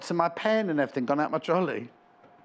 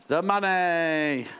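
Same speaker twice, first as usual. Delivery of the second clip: slow, drawn-out put-on voice; mock Loadsamoney drawl, very slow; Harry Enfield's Loadsamoney; much slower than usual. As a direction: stretch it out like a cocky catchphrase. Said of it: "that Harry Enfield character".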